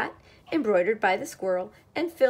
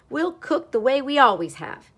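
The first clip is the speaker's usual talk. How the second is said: loud, higher firm retort; snapped; 5 semitones higher, 8 dB louder than usual. A narrator's reading. Cat refusing to change the routine, snapped. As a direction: lay down the rule loudly. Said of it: "snapped the cat".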